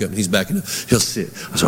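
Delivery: usual speaking voice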